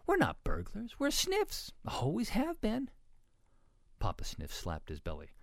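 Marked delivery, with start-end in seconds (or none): 0.1-2.9 high, lilting, unforced voice; Mama pig. A narrator's reading. Mama pig tells Ruby they are Sniffs, high and lilting. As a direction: sing it up high without raising volume.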